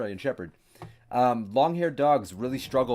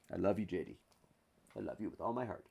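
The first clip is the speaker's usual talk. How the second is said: soft loving murmur; 3 semitones lower, 12 dB quieter than usual. Real, not acted. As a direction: murmur it softly, much quieter, to the dog. Cooing softly to the dog.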